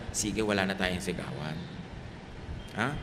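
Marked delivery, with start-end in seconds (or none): 0.1-1.5 soft, low voice; hushed, lowered agreement; gentle truce. Acted. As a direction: offer a quiet promise to a spouse. Models couples' no-shouting promise.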